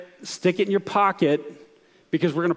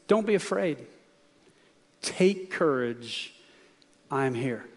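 gentle reassurance; slower than usual. Acted. Jesus' words repeated gently.

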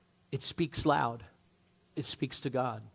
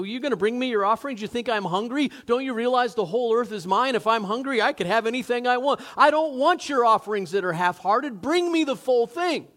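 indignant God; 6 semitones higher, 4 dB louder than usual. Retold or imitated. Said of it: "a text in the scripture".

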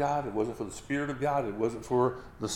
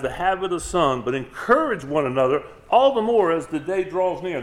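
loud, raised preacher's reading of a verse; scripture reading; 4 semitones higher, 7 dB louder than usual. Acted. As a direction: proclaim the verse loudly to the congregation. Reads scripture aloud.

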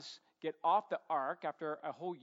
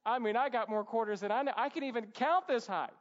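put-on higher, louder, quick boyish glee; childlike excitement; 5 semitones higher, 4 dB louder than usual. Four-year-old counting his stolen treasure, acted giddy excitement. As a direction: burst out thrilled like a small kid. Child gloating over grabbed quarters.

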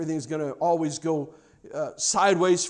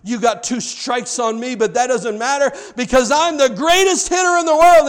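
determined boast; 7 semitones higher, 9 dB louder than usual. A narrator's reading. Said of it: "and he said".

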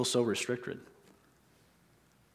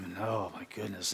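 low, much softer, deflated; downcast sigh; 4 semitones lower, 10 dB quieter than usual. Shown as a demonstration. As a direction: sound defeated and weary. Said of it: "if somebody's talking like this all the time".

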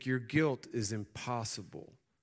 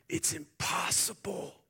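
whisper; 12 semitones higher than usual. Acted. Angel voiced in sermon.

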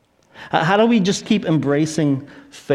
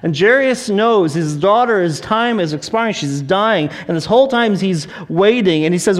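usual speaking voice

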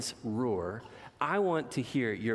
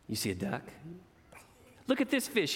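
plain reading voice

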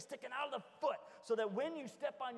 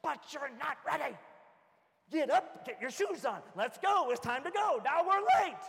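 loud, high scolding shout; exasperated parent; 4 semitones higher, 10 dB louder than usual. Retold or imitated. Dad scolding kids who have no shoes on, a loud high shout. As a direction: scold them loudly. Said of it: "continues scolding".

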